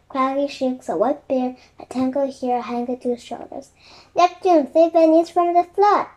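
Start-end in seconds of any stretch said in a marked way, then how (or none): 4.2-6.1 higher, louder appeal; urgent storybook plea, raised; pleading cry. A narrator's reading. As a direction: call up to beg a giant for help. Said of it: "cried Jack".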